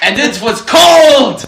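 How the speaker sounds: loud, high, slow German shout; German accent. Retold or imitated. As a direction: belt it in a hammy German accent. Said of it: "I wish I knew how to do a German accent".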